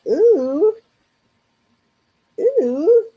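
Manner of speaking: mock-alarmed ooh, pitched well up; wincing reaction. Retelling her look into the housing costs, wincing.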